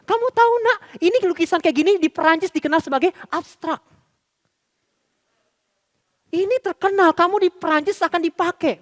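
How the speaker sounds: high, hammed-up motherly praise; over-praising mother. Mother telling child the drawing is abstract art, high exaggerated praise. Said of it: "dia bilang".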